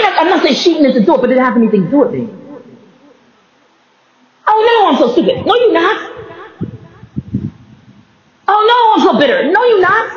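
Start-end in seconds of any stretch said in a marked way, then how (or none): 4.5-10.1 mock lament. Acting out calling herself names and answering back, high mock lament. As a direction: wail it high, then answer just as high.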